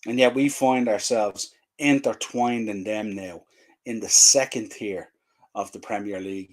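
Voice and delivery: ordinary talk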